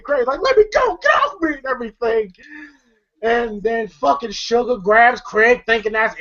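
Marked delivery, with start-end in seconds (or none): 0.4-1.5 high panicked let me go; panicked plea. Retold or imitated. Craig pinned by his uncle, a high panicked plea. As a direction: squeal to be let go. Craig struggling, retold quote.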